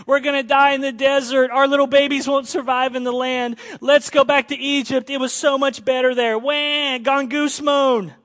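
loud, high mock whining at Moses; hammed-up childish wail; whiny Israelites. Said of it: "they rebel and grumble again".

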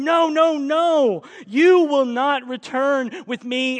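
loud, high, forceful repeated no; emphatic refusal. Acted. Naomi refusing to let her daughters-in-law return, emphatic. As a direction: thunder the refusal, driving every word home. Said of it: "it's emphatic".

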